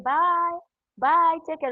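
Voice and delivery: sing-song parting call; calling goodbye. Retold or imitated. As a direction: call farewell across the road.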